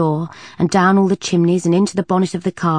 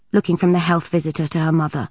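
plain reading voice

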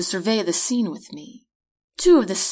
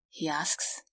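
ordinary delivery

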